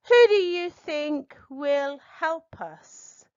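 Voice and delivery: slow, "Who" hit loud and high; slow question leaning on "Who"; "Who" punched, then steady; stress on WHO. Shown as a demonstration. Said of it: "listen for inflections".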